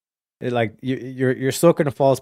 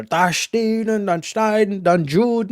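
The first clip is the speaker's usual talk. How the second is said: a hint of a slow, higher mock German speech; mock Adolf rant; 7 semitones higher, slower than usual. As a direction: lightly ham up a ranting dictator, slowly. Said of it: "fucking shouting through the mic".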